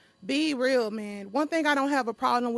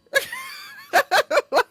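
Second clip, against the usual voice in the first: shrieking burst of laughter; loud high laugh; 6 dB louder than usual. Real, not acted. Laughs after "lie" quote.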